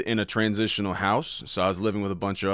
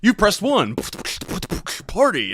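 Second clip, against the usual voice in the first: automated phone message; 9 semitones higher than usual. Retold or imitated. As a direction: deliver like a recorded system. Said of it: "automated response".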